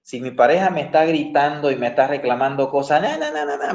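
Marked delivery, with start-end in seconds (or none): 3.0-3.7 high sing-song mock nagging; lightly mocking babble of a scolding partner. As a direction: parody the partner's endless complaints.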